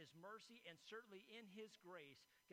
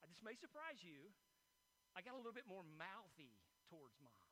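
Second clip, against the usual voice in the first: usual speaking voice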